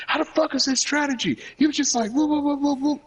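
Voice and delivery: high mock whooping; high, teasing retold taunt; mocking old man. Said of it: "He was like".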